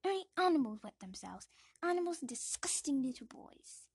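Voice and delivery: loud contemptuous aunt; Aunt Petunia. Acted. Aunt insulting the boy, loud contempt. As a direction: spit it with contempt. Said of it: "says Aunt Petunia".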